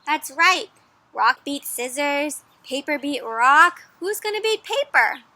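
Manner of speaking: ordinary delivery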